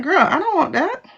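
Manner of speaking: put-on high, dismissive girl-talk; sassy refusal. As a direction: brush off the offer with attitude. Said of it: "It's like".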